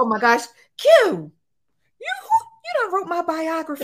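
high, loud, delighted outcry; excited shout. Real, not acted. To the host who nailed her story, a thrilled shout. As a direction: shout it out, thrilled she gets it. Excited reaction to host's words.

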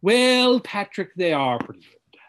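pompous put-on thespian reassurance; hammy actor impression, settling down by the end; Kelsey Grammer. Shown as a demonstration. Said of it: "That's Kelsey Grammer".

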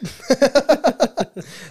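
high loud laugh; real laughing burst. Real, not acted. Retelling the fight, a high loud laugh.

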